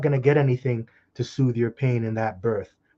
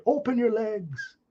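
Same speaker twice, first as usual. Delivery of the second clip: high, drawn-out mock order; caricatured high-pitched demand; acted attacker's command; 10 semitones higher, 3 dB quieter, slower than usual. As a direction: play an invader giving a stretched, strained command. Acts invading attacker's order.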